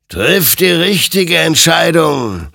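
high, slow otherworldly warning; the creature warns Hektor, slowly and eerily. A narrator's reading. Creature warns Hektor gravely, high and slow. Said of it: "ermahnte ihn sein Gegenüber".

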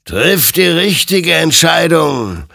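loud, high, drawn-out command; stern admonishing creature. Acted. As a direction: exhort him slowly and forcefully. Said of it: "ermahnte ihn sein Gegenüber". (laut und gedehnt ermahnend)

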